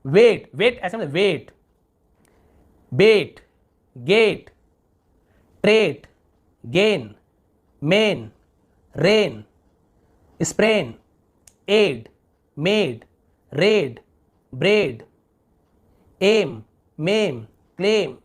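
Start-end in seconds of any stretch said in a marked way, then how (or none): 0.0-0.5 वेट with a long, held "ay"; slow model वेट, vowel drawn out. Shown as a demonstration. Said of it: "थोड़ा लंबा ए". 2.9-18.1 each word from बेट to क्लेम with a drawn-out "ay"; isolated model words, बेट, गेट, vowel held long; long A word list. Shown as a demonstration. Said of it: "थोड़ा लंबा ए ऐसे उच्चारण हो रहा है".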